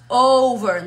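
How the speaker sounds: "Over" with a gliding OH-oo, loud and clear; teacher's emphatic "Over"; clear English diphthong O. Shown as a demonstration. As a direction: model "Over" slowly with a rounded English O. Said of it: "No O, sino O".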